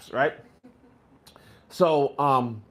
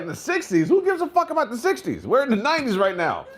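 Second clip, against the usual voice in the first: high, mocking scoff; sneering teen voice, pitched up; scoffing kid; 9 semitones higher than usual. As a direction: brush off the old-timer's story with contempt. Kid scoffing at the old-timer.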